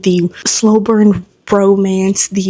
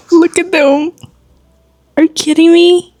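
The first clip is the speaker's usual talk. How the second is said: disbelieving outcry; 7 semitones higher, 3 dB louder than usual. Real, not acted. Frantic over a cliffhanger ending.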